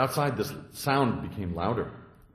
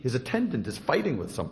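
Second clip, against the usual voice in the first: ordinary talk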